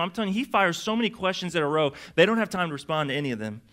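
usual speaking voice

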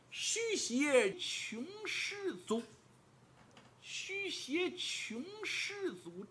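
very slow, drawn-out chant; slow solemn recitation. Acted. Scholar slowly chants the riddle line to Li Kui. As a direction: intone each syllable like an oracle. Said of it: "你呀就记住这十个字".

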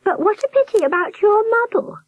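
very high sympathetic warble; sympathetic nurse. A narrator's reading. Nurse bending toward Albert, sympathetic high warble. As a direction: commiserate in falsetto. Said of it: "she murmured sympathetically".

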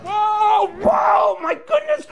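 very high squealing falsetto; mock astonishment. Entertainer pretends children sneezed him out of frame, hammed-up shock. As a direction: wail it high and slow as if knocked over.

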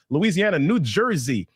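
ordinary delivery

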